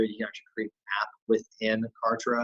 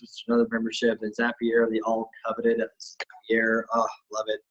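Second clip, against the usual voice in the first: ordinary delivery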